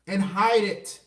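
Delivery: usual speaking voice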